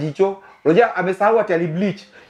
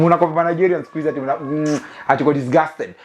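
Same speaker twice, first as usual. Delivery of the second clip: ordinary talk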